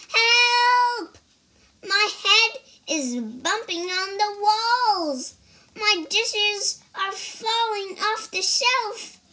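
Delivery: high, loud squeaky worm cry; child voicing a frantic little worm. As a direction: yell for help as a tiny panicked worm. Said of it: "cried the worm".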